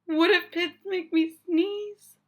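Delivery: rising panic in the voice; worried. Acted. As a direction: panic about the sneezing. Gerald worries.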